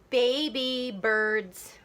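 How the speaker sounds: very slow, separated beats; counting out syllables. Shown as a demonstration. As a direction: draw out each syllable. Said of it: "let's count the syllables".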